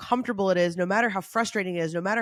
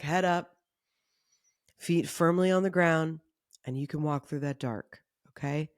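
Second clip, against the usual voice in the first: calming guidance; 3 semitones lower than usual. Acted. Leads a calming exercise.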